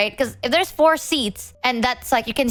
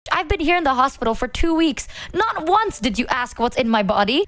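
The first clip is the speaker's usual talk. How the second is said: ordinary talk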